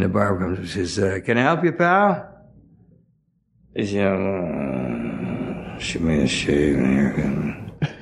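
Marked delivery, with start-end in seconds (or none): 1.2-2.3 higher-pitched barber asking; chirpy barber offering help. Retold or imitated. Barber greets the nodding junkie, higher. 4.1-7.9 low, slow, drowsy junkie mumble. Retold or imitated. Junkie asks the barber for a shave, low and slow.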